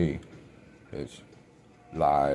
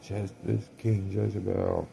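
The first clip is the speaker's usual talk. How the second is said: slow, higher declaration; a hint of a battle cry; mock proclamation; 6 semitones higher, slower than usual. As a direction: proclaim it like a war cry, lightly. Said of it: "shot my shotgun and said".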